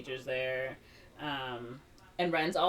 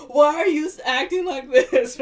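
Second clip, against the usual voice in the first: much higher and louder scolding; angry uncle; 10 semitones higher, 13 dB louder than usual. Acted. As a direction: yell at the nephew.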